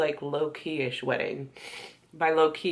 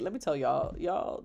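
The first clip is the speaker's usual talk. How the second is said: usual speaking voice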